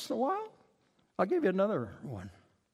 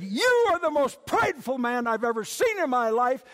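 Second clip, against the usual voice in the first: higher, louder accusing voice; scolding rebuke; 6 semitones higher, 6 dB louder than usual. Acted. Elderly lady rebukes preacher in elevator, acted scolding. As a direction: rebuke pointedly, raising pitch and volume.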